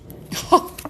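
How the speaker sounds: airy, voiceless laugh; brief incredulous laugh. Real, not acted. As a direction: laugh in short puffs of breath. Scoffs at the outcome she sees.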